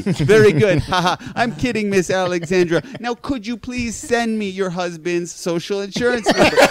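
hammed-up phone scammer sweet-talking a mark; scam caller imitation. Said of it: "my imitation of those scams".

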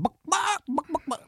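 jumping high for each "buck, buck" cluck; chicken noises. Shown as a demonstration. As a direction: cluck like a hen about to lay. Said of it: "Start doing some random chicken noises".

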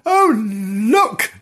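put-on delighted girl. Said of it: "said Gillian".

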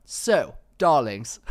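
loud mock-socialite announcement; Charlotte Tilbury. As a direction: begin a speech as a posh hostess. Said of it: "Then she went".

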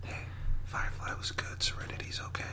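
soft unvoiced whisper. Real, not acted. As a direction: keep it to a whisper. Whispered chat before recording.

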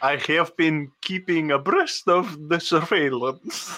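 put-on Russian spy accent sliding into Glaswegian; mangled Scottish-Russian accent; Russian accent gone Scottish. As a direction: let a Scottish brogue creep into the Russian. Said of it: "have you been living in Glasgow for some time?".

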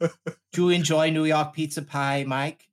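put-on very slow, laboured American accent. Sitcom flatmates discussing pizza, hammed-up slow American accent. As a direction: stretch the words out in a fake American accent. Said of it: "fifty percent engaged in the accent".

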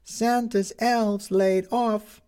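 high, slow headline cry; newsboy calling headlines. Acted.

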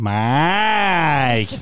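Mike! long and punched; drawn-out Mike. Shown as a demonstration. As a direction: shows the accent on the i: Mike!. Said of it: "avec l'accent tonique sur le i".

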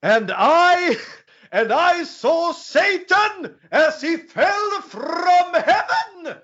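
very high, slow, drawn-out villain proclamation; hammed-up Raul Julia as Bison, stretched long and high; mock-theatrical villain speech, rising to a cry; Bison's hammy quote. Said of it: "då säger han följande".

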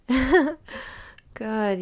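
giddy loud laughing gasp. Acted. Passionate moment in roleplay.

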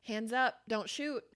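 ordinary talk, nothing marked

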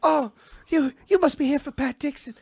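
high, sultry woman greeting; noir femme fatale. Pretend. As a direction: purr it high like a film siren.

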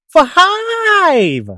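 high, loud, long-stretched shout; big announcement. Acted. Narrator reveals the town settled on five, stretched shout. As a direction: blow up the word into a drawn-out cry.

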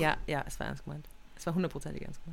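fast, breathy whisper; hushed, hurried confirmation. Real, not acted. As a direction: whisper it like a secret.

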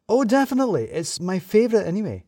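excitable gushing. A narrator's reading. Sue gushing to a colleague about her favourite, excitedly. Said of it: "Sue seemed a lot more excitable".

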